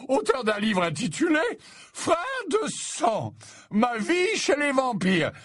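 very high, slow jovial bellow; booming old professor. A narrator's reading. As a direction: boom out a hearty welcome. Slughorn voiced, far high.

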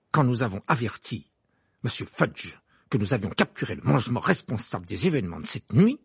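ordinary delivery

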